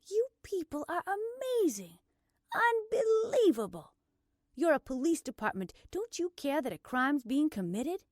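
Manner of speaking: high, loud indignation; outraged disbelief. Acted. Blair berating the police department, outraged. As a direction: erupt at their indifference.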